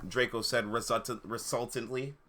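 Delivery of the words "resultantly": plain reading voice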